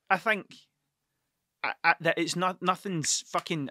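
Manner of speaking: ordinary talk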